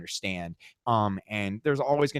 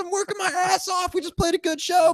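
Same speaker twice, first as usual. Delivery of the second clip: whiny self-pity; 5 dB louder than usual. Retold or imitated. Telling cohost his tour frustration in a whiny squeal. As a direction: squeal it like a wronged kid. Retold inner protest, falsetto.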